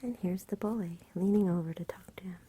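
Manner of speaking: usual speaking voice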